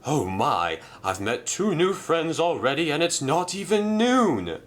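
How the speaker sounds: delighted at making friends; lightly voiced cheerful engine.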